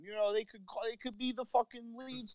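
high joking Jersey voice; high hammed-up Jersey accent; mock Jersey accent. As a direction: play the excited local guy.

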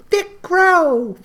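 squeaky high fairy chirp; mock fairy squeak; tiny fairy voice. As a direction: pipe it up like a little fairy. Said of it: "little fairies just flew in and go".

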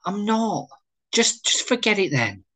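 high, stumbling retreat; stung, defensive withdrawal; hurt defensiveness. Acted. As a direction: protest high, then drop the subject. Wayne withdraws after being rebuffed.

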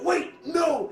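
high-pitched mock cry of protest; alarmed shout. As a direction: act out stopping someone in a panic. Game master voices alarmed players.

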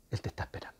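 hushed whisper, very soft. Real, not acted. Preacher to listener, whispering that God waits. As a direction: whisper it as a gentle secret.

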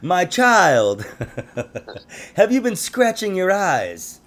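slowed, theatrical doctor-like quote ending in a loud Good Lord; hammy interviewer impression. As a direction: ham it up like a pompous old doctor. Mocking the interviewer's line.

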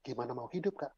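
soft, low, subdued plea; imitated tearful despair. Teen girl in tears asks her counselor, soft imitated despair. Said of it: "Ini udah nangis nih".

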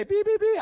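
high, loud electronic beeps; imitated detector beeps. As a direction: sound like a detector going off. Detector going off over nice trips.

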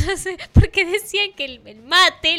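laughing. Real, not acted. Host cracking up retelling the mate meme, laughing. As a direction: laugh out the punchline.

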